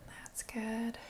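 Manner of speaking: soft unvoiced whisper of praise. Real, not acted. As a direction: whisper it so as not to disturb. Whispered praise to breathing client.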